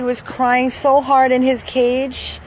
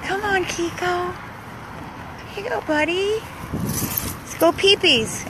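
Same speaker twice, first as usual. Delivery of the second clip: gentle coaxing; 6 semitones higher, 5 dB quieter than usual. Real, not acted. Coaxing old dog gently.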